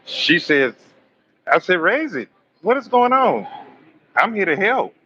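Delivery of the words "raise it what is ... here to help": excited demand. Retold or imitated. Urgent demand in retold scene.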